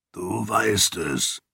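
lightly voiced low rasp, slow and soft; a hint of the uncle's rough rasp; deep rasping voice. A narrator's reading. Uncle Kiin answering his niece in a low rasp. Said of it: "sagte Kiin mit seiner tiefen Raspelstimme".

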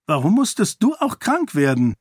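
high-pitched peeved reproach; annoyed child. A narrator's reading. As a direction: blame your brother in a childish huff. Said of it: "sah sie ihren Bruder verärgert an". (verärgert, kindlich vorwurfsvoll)

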